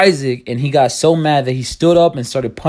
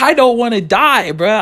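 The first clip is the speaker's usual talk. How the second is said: higher, slow begging whine; a pleading, drawn-out whine; 8 semitones higher, 3 dB louder, slower than usual. Retold or imitated. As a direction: beg them not to make you go. Pleading with friends not to go.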